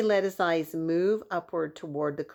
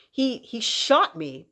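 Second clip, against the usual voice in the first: shocked voice; 5 semitones higher, 6 dB louder, slower than usual. Acted. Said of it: "in a shocked voice".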